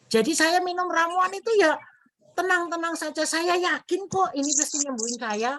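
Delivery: a light high wife's voice, unbothered; imitating a cheerful wife. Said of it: "Itu jawaban istrinya". (menirukan istri pasien yang santai dengan suara lebih tinggi)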